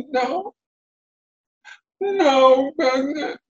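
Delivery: high, slow, wailing turtle voice; ruined Terrapin. Acted.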